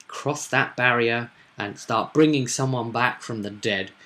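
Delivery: normal talking voice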